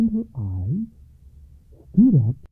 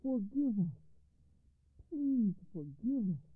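pleading; 10 semitones higher, 11 dB quieter than usual. A narrator's reading. Sons asking their mother's forgiveness, high pleading. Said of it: "cried the general".

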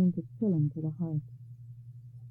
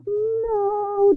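a sudden, much higher and louder roar of refusal; bellowing; 11 semitones higher, 6 dB louder than usual. Acted. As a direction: explode with a furious No. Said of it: "Death bellowed".

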